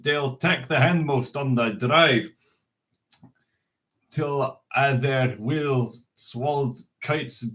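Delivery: reciting Burns in a lightly put-on Scots accent; an unpractised Scots accent, recited; Scots poem reading. Shown as a demonstration. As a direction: read it out like a Burns Night address in Scots. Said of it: "I'm not used to speaking Scots".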